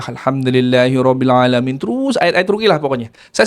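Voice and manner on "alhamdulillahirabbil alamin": Quran recitation. Acted. Reciting Al-Fatihah.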